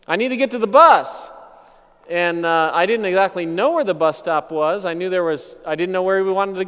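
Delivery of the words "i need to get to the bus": louder, higher pleading question; loud asking. Retold or imitated. Stranger asks him for the bus at night, loud and urgent. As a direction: call over urgently for directions. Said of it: "and he's like".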